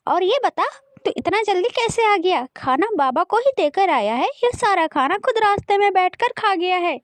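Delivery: high, sarcastic scolding; taunting stepmother. Acted. Stepmother accuses the brother, taunting. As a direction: needle the boy in a high voice.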